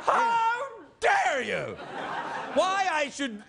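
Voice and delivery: high, hammed-up indignant shout; mock outrage. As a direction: explode with comic offended dignity. Mock quarrel, threatens to report him.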